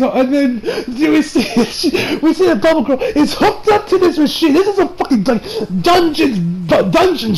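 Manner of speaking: high voice breaking into laughter; real giggling. Real, not acted. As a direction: struggle to talk through the laughs. Laughing while retelling the scene.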